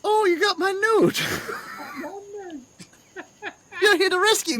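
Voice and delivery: high, delighted falsetto; Herbert the rescued prisoner. Retold or imitated. Herbert greets his rescuer, in a high gushing voice. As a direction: put on a high, excitable voice.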